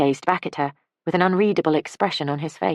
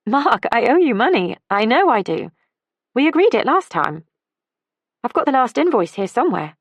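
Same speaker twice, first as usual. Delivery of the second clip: raised, firmly insisting; lightly voiced woman pressing to pay; Ellie's voice, insisting; 9 semitones higher, 6 dB louder than usual. Ellie insists on paying Mark.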